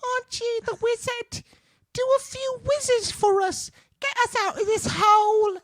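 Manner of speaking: very high squeaky piglet; tiny piglet pleading in falsetto. Acted. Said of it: "Do the piglet voice".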